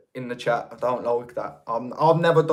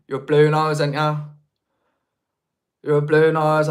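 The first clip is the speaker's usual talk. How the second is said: usual speaking voice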